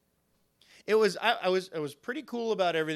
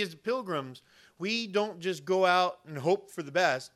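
normal talking voice

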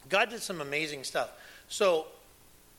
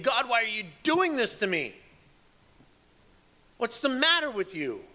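higher and louder, retelling an exasperated plea; frustrated cry to God; 8 semitones higher, 6 dB louder than usual. Retold or imitated. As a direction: replay the frustration, pleading at God, voice up. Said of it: "being so frustrated and saying".

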